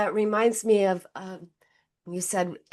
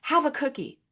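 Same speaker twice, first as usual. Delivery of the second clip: mom offering cookie; 6 semitones higher than usual. Retold or imitated. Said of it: "And she's like".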